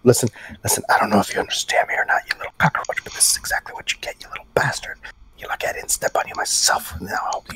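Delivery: fast whisper through cupped hand; hand over mouth. Acted. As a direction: hiss it into your hand. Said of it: "He's speaking through his hand in the cup".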